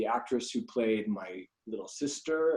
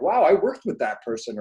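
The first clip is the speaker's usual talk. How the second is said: high, loud mock wow; retold amazement; 7 semitones higher, 8 dB louder than usual. Recalling spotting a former co-star, loud mock amazement. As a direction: play the sudden realisation big. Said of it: "I was like".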